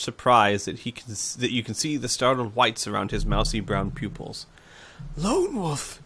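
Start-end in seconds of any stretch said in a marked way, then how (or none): 5.2-6.0 incredulous whisper. Acted. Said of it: "whispers incredulously".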